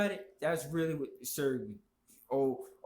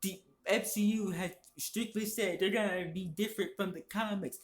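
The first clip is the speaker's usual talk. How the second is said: higher, whiny mock voice of a know-it-all fan; higher, whiny mimicry of an online opponent; mocking the male feminist; 7 semitones higher than usual. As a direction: parody the smug fan higher and whinier. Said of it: "This dumb motherfucker wants to talk".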